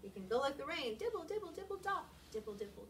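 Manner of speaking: lively picture-book reading with big sound words; expressive read-aloud. Acted. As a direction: read it to the kids with full expression.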